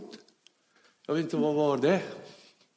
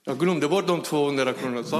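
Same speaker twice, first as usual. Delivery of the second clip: ordinary delivery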